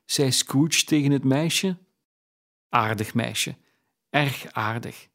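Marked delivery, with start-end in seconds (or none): none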